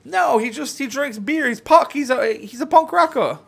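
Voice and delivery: high, loud cartoon Canadian voice; a hammed-up Canadian from up north; mock Canadian accent. Telling Justin where he lives, in a mock Canadian accent.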